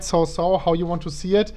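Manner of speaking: normal talking voice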